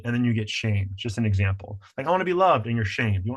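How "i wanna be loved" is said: put-on higher childlike plea; pleading child. The inner child asking to be loved, higher plea. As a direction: ask for love like a small kid.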